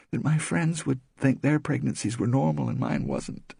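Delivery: a hint of worried young woman; lightly voiced Rosemary. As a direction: confide it, uneasy. Rosemary repeats doctor's warning.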